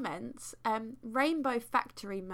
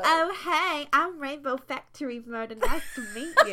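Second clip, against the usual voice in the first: higher, louder hammed-up greeting; mock friendly introduction; 5 semitones higher, 9 dB louder than usual. Host mocks commenter greeting Slender Man, over-friendly introduction. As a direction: introduce yourself with exaggerated cheer. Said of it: "you would be like".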